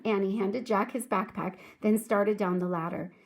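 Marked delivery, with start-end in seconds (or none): none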